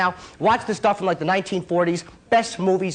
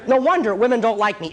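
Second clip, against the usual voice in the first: ordinary talk, nothing marked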